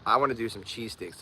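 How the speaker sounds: ordinary delivery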